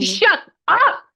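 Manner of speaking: loud, high squeal of delight; thrilled disbelief. Real, not acted. Reader hearing client graduated law school, thrilled. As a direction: burst out in amazed joy.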